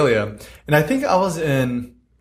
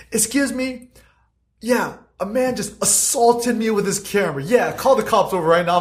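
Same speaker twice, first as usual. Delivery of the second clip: much higher mock complaint to the police; imitating the lady on the phone; 8 semitones higher than usual. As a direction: pitch way up, hammed-up victim on a call. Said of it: "picks up the phone, says".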